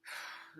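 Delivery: soft breathy sigh; weary sigh. A narrator's reading. Walrus faces Turtle's tantrum, sighing. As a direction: let out a long sigh.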